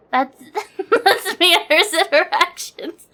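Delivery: words squeezed out through giggles; laughing while talking. Real, not acted. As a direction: say it while cracking up. Laughing about friend.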